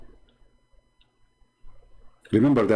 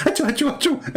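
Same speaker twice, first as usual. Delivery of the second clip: high silly sneezes breaking into laughter; squeaky mock achoo while laughing; laughing fake sneezes; faster than usual. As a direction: giggle through the pretend sneezes.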